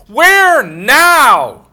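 high, loud ringing cry of God; God taunting death, exultant; triumphant proclamation. Acted.

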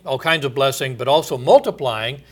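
normal talking voice

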